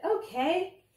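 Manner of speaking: high, loud, eager agreement; enthusiastic. A narrator's reading. Danny agreeing to hear the truth, enthusiastic. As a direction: pipe up keenly with a rising lift. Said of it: "With enthusiasm".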